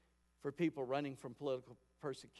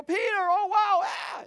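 high, loud startled cry of joy; mock surprised shriek; 12 dB louder, slower than usual. Church members seeing Peter at the door, shrieking in surprise. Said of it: "They go".

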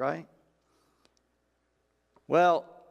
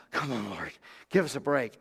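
weary grumble; 5 semitones lower than usual. Retold or imitated. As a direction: sigh out the complaint like tired men. Disciples grumbling at Jesus.

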